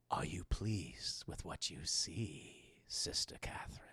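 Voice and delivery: slow, low, hushed whisper; sinister other voice. Retold or imitated. An unseen voice questions Catherine, in a slow eerie whisper. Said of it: "we hear this other voice in the room".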